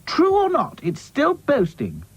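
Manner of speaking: a high, prim doll voice. Acted. Doll scolding a boastful friend, high voice. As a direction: lift the pitch, a prim little doll complaining.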